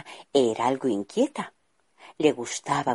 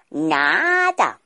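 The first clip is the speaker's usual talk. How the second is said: a bit louder, teasing king; amused; 4 semitones higher, 5 dB louder than usual. Acted. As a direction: toss it off playfully.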